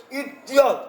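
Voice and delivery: ordinary talk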